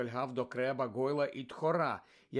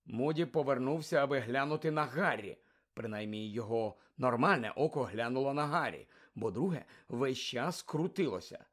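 plain reading voice

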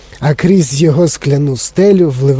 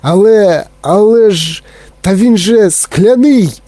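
higher, halting, drawn-out protest; alarmed shout; 5 semitones higher, 3 dB louder than usual. A narrator's reading. Grandpa Joe protests about the glass lift, alarmed. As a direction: stammer the objection, then stretch the cry. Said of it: "закричав дідунь Джо".

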